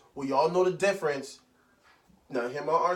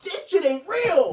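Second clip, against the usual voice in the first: disbelief; 11 semitones higher, 5 dB louder, slower than usual. Real, not acted. Stunned by the Ford logo.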